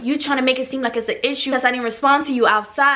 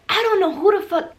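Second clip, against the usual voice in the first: normal talking voice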